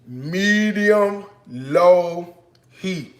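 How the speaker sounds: loud, drawn-out leading chant; call-and-response prompt. Shown as a demonstration. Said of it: "Come on, say it with me".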